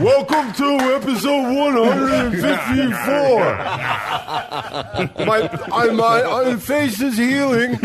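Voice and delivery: a cheesy video host greeting viewers; YouTube host voice. Pretend. Mock YouTube intro.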